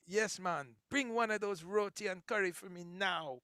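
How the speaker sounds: Guyanese-Caribbean lilt, rising to a loud high call on the hot sauce; Caribbean accent. Shown as a demonstration. Host demoing his Caribbean accent to the chat. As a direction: show off a Caribbean accent ordering roti and curry. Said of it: "I can do all the Caribbean accents now".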